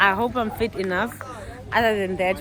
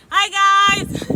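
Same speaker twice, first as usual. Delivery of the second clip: loud greeting; 11 semitones higher, 6 dB louder than usual. Real, not acted. Greeting camera loudly mid-hike.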